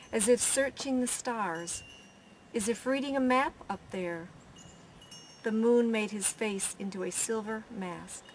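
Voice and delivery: plain reading voice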